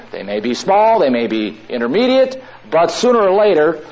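ordinary talk, nothing marked

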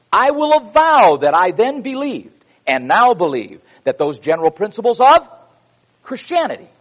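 fervent preacher's delivery; preaching a quote. Acted. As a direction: proclaim it to the congregation like scripture.

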